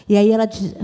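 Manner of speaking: usual speaking voice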